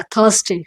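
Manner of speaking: "थर्सडे" as THUHS-day, falling; model pronunciation. Shown as a demonstration. Teacher confirming for learners, "थर्सडे" as THUHS-day. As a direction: say "थर्सडे" with a silent r. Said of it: "तो ये क्या है?".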